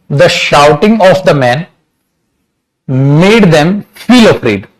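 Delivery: very slow, word by word, with pauses; slow dictation. Shown as a demonstration. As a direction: dictate it phrase by phrase for notes. Teacher dictates sentence slowly. (धीमे-धीमे लिखवाते हुए)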